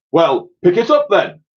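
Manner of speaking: loud barked army order; ordering sergeant. A narrator's reading. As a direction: command it like a drill sergeant. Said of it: "he ordered".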